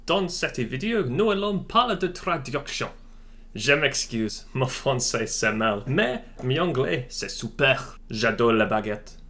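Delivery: hammed-up French accent, light touch; put-on French accent for a joke intro; mock French accent. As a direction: play the cliché Frenchman greeting the viewers. Jokey French-speaking intro.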